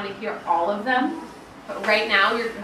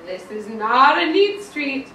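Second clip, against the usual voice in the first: disapproving stranger; 3 semitones higher, 7 dB louder, slower than usual. Acted. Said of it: "the stranger would say".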